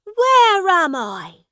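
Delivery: high, loud, drawn-out question; alarmed prince. A narrator's reading. Prince dragged into a strange room, alarmed. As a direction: demand it slowly in a high boy's voice.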